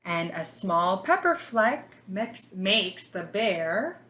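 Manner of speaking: put-on lively storybook read for little kids; reading to children. A narrator's reading. Teacher reading a picture book to her class, lively storybook voice.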